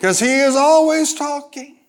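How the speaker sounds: punchy, high-energy pulpit delivery; full-force preaching. Real, not acted. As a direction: drive every phrase home to the congregation.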